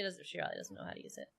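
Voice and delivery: fast, unvoiced whisper; whispered aside. Real, not acted. Whispering an aside about the viewer. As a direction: whisper it quickly under your breath.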